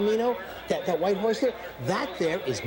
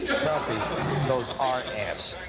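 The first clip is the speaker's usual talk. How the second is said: patient correction; 8 semitones lower, much slower than usual. Acted. Corrects his dim friend.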